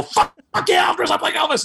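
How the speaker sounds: high, loud retold whoop of delight; excited yell. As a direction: shout it out like you can't wait to say yes. Retells eagerly accepting [name] request.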